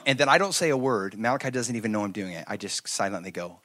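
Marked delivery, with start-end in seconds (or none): none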